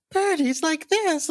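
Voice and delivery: very high, drawn-out cartoon voice; Birdie. Remembering another sister's dub voice, very high impression. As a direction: squeak it out high and slow. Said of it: "I've got a very high voice".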